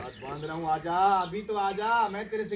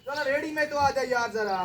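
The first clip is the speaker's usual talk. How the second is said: calling out; 9 semitones higher than usual. Real, not acted. Calling buffalo across the field.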